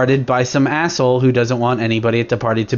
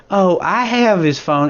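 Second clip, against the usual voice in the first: put-on high, slow country accent for the lady; 7 semitones higher, slower than usual. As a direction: draw it out in a country accent, pitched up. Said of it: "she's country accented".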